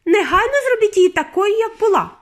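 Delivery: very high, loud, furious order; screamed demand. A narrator's reading. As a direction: shriek your demand. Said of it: "закричала пані Борегард".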